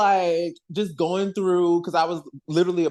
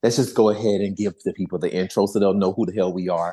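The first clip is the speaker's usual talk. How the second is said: much lower, playful booming voice; mock deep voice; 9 semitones lower than usual. Host to co-hosts, teasing in a mock deep voice. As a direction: drop the voice way down for a joke. Keeps deep voice for intro cue.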